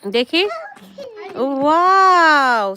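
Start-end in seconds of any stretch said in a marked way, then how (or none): 1.4-2.8 very long, loud, higher wow; big drawn-out exclamation of wonder; admiring wow. Real, not acted. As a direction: stretch it out in delighted praise. Praising child's harvested pumpkin.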